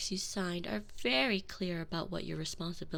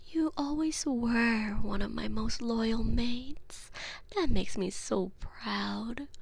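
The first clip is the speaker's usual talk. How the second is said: tender praise; 4 semitones higher, 4 dB louder, slower than usual. Acted. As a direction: praise her slowly like a beloved pet.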